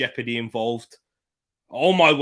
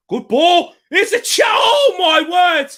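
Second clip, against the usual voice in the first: very high, loud cry of shock; excited shout; 13 dB louder than usual. Real, not acted. Fan on a near goal, very high loud shouting outcry. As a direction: scream at the missed chance. Big chance for City.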